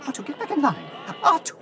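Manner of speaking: exasperated shout across the lot; scolding call. Acted. C-3PO scolding R2 in line.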